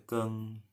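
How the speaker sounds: keng quietly ending in nasal ng; soft nasal keng. Shown as a demonstration. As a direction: give keng a nasal ng close. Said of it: "pakai sengau hidung".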